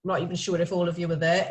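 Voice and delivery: ordinary talk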